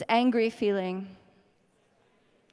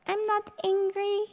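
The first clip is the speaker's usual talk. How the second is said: high, bright-smiled "I'm fine" masking anger; fake cheerful denial; 9 semitones higher than usual. Tapping client hides anger behind a smile, falsely cheerful. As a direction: sing it out sweetly to hide the rage. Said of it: "I'm hiding it behind my smile".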